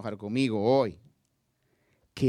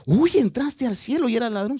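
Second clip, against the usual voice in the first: much higher and louder, scandalized jab; mock objection; 10 semitones higher, 9 dB louder than usual. Preacher voicing an imagined critic mocking the thief, scandalized. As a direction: squeal it like a shocked gossip.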